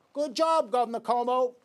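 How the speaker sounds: high, loud mock congratulation; sarcastic praise. Real, not acted. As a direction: jeer at Cuomo with fake praise. Mocking the governor's bad appointees.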